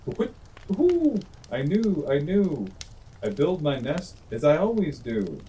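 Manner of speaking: high, loud hoots then a boastful owl voice; a proud owl hooting off. A narrator's reading. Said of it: "said the Owl".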